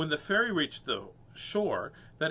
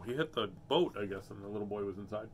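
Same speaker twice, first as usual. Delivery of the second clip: ordinary delivery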